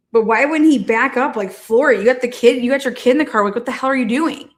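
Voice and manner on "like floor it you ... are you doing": ordinary talk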